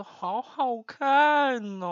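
slow, high, drawn-out teasing. Retold or imitated. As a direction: rub it in, slowly. Teasing friend who watched first. (慢吞吞地炫耀)

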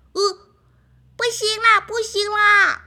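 high, loud panicked no; duckling pleads with them to stop; alarmed protest. Acted. Duckling begs friends, high and panicked.

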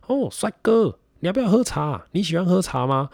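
high, loud friendly auntie offering tea; cheerful auntie hospitality; shopkeeper auntie. Retold or imitated. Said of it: "他就突然就蹦出一句说".

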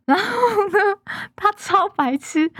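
high-pitched, laughing through the words. Real, not acted. Podcaster mocking a silly friend to listeners, laughing. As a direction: barely get it out for laughing.

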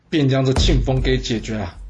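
ordinary delivery

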